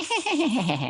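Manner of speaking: high, mocking villain cackle; high-pitched imitated evil laugh; imitated creepy laugh. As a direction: cackle like the sinister pen. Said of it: "Oh, I hate that laugh".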